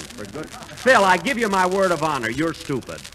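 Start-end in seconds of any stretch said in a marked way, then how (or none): none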